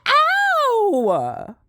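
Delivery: high squeaky mock ow; lady's yelp. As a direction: squeal it like a startled lady. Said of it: "she said".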